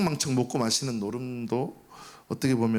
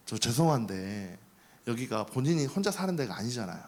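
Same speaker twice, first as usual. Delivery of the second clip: softer, hesitant, courteous plea; polite request; 2 semitones lower, 4 dB quieter than usual. Acted. Seminarian politely asks noisy upstairs neighbor for quiet, courteous. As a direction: ask politely, keeping it gentle. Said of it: "정중하게 말한 게 아니라".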